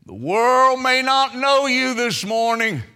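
high, loud sustained preaching; preaching at full force. Real, not acted. Preacher assuring believers the King visits, full-force preaching. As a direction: drive each phrase home to the congregation.

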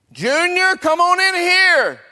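very high, loud, stretched holler. Acted. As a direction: holler for the child to come inside. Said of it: "And Mama calls out again".

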